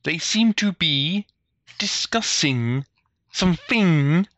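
normal talking voice

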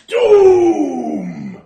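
loud, high, drawn-out proclamation; creature's doom cry. Acted. Little creature points at the party, ominous cry. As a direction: boom the word out long and high as an alarming omen. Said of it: "He points at you and he says".

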